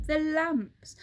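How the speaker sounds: ordinary delivery, nothing marked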